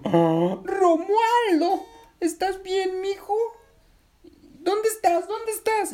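gasp, then high-pitched panicked calling; very high, alarmed mum voice; frightened mother. A narrator's reading. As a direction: call out for your hurt child in alarm. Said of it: "la señora se asustó mucho".

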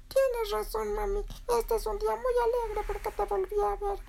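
very high little chick squeak; Berilio. Acted. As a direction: chirp happily to mom in a tiny voice. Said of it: "Y dijo".

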